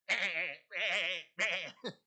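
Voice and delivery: a big loud laugh bursting out; loud burst of laughter. Real, not acted. (carcajada fuerte)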